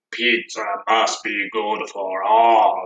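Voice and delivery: low, slow Cookie Monster growl. Shown as a demonstration. Teacher demos the last line for kids, low Cookie Monster voice. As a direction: gobble the words like Cookie Monster. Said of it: "like the Cookie Monster does".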